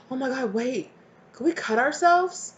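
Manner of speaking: put-on high-pitched startled kid; mock dismay. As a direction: act a worried child, pitch climbing. Acts kids realizing they're hurt.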